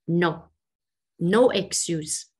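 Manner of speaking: ordinary talk, nothing marked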